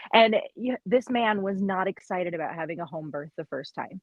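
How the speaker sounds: ordinary talk, nothing marked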